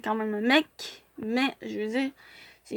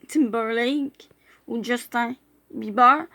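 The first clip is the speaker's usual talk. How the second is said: normal talking voice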